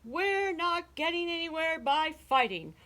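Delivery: high, loud shout easing slightly; shouted order. A narrator's reading. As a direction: burst out to silence a squabbling crowd. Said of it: "the chief frog shouted out".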